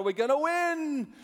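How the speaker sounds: high, drawn-out team yell; full-voiced rallying cheer. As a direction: shout it long and loud. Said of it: "They raise their voice a little louder".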